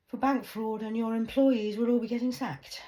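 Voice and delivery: high, loud warning; shouted threat. Real, not acted. Woman threatening HSBC with investigation, loud shout.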